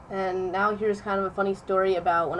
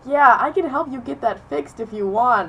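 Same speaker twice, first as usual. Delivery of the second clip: higher, louder offer of help; retold helpful teacher; 5 semitones higher, 7 dB louder than usual. Teacher offering to fix the record, helpful voice. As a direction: lightly imitate an eager-to-help teacher.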